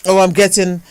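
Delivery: ordinary talk, nothing marked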